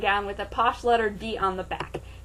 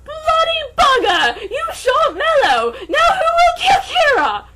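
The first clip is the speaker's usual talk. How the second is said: shrieking, much higher and louder; hysterical shout; 9 dB louder than usual. Acted. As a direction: scream it like the world is ending. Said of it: "He saw Dark's gun and shouted".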